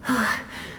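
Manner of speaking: a quiet unvoiced sigh; soft sigh. Spirit sighing before thanking the director. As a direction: let out a breath of relief.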